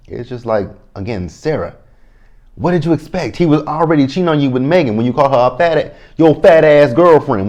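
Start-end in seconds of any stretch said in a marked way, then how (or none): 2.6-7.5 worked-up rant. Real, not acted. Worked up over the drama.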